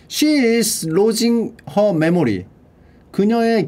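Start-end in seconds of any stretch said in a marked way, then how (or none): 0.1-2.4 very slow, word by word; slow deliberate English reading; slow model reading. Shown as a demonstration. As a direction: read it out slowly for learners. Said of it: "reading example sentence slowly".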